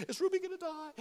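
high, soft whimper imitating upset kids; retold crying children. Kids fretting over the sick parrot, imitated high, soft whimper. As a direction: put on a small, tearful child's voice. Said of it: "they're crying".

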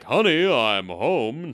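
exaggerated sitcom-husband singsong, drawn out; over-the-top fifties acting. Mimicking Tim acting in his son's film, hammy fifties delivery. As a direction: ham it up like a Leave It to Beaver dad. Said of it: "really over-the-top kind of Leave It to Beaver fifties acting".

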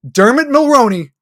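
real loud jeer at the actor; loud put-down. Real, not acted. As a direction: belt it out in disgust. Ranting about a bad actor.

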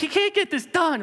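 high, worked-up self-reproach; acted frustrated outburst. Man stuck mid-move berating himself, frustrated. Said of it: "I'm a frustrated".

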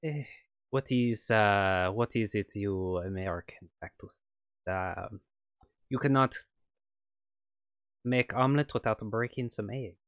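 put-on light on-and-off French accent as the villain; a French scientist, accent slipping in and out. GM as French ex-colleague on the phone, light French accent. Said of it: "excuse my terrible French accent".

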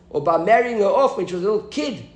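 usual speaking voice

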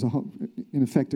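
normal talking voice

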